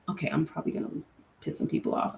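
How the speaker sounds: fast conspiratorial whisper; quick hushed aside. Real, not acted. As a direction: rush it out in a whisper like a confession. Confiding aside before a hot take.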